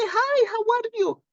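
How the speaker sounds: high, bright hello to a patient; cheery greeting. Retold or imitated. Greeting a silent patient at the clinic, high and cheery. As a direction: sing out a friendly hi. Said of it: "and I'll say".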